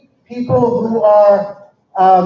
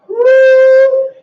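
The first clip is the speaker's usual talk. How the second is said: high cheering woo; excited whoop; 6 dB louder than usual. Real, not acted. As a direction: whoop it up for the crowd. Cheering for the crowd.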